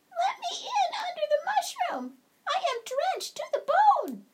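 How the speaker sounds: very high, tiny squeak of a mouse; squeaky mouse. Acted. Drenched mouse squeaks to be let in under the mushroom. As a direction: squeak it out like a soaked little mouse.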